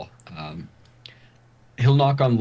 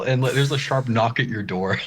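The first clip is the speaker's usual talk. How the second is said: ordinary delivery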